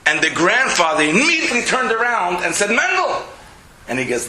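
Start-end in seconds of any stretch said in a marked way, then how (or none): 2.7-3.2 sharp high call of a name, much higher. Retold or imitated. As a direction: grandfather turning to call the boy. Grandfather calls the boy.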